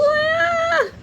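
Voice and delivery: very high swooning shriek; short high delighted squeal; fangirl squeal. Retold or imitated. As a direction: squeal like a smitten reader. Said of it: "And I'm like".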